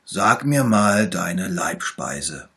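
calm him down, slowly and warmly; little bear. A narrator's reading. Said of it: "sagte der kleine Bär".

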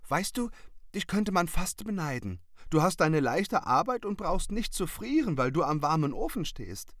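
high, lively little witch voice; little witch, envious. A narrator's reading. Little witch envies the seller his warm job, high and eager. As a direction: pipe up in admiring envy.

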